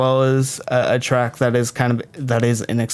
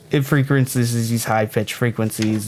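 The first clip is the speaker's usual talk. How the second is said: normal talking voice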